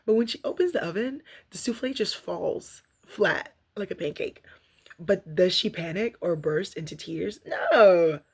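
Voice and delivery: slow, emphatic reading from a book; reading aloud. Acted. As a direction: read each line like a lesson to listeners. Reads from daily reader.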